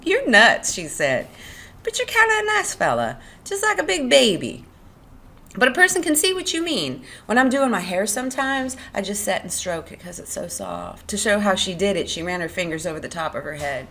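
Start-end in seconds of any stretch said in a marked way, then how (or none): 0.0-0.7 high, loud amused jab; laughing tease. A narrator's reading. Curley's wife laughing at Lenny, amused and teasing. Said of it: "Curly's wife laughed at him". 1.8-11.1 high-pitched chatty woman's voice. Acted. Curley's wife confiding in Lenny, high and friendly.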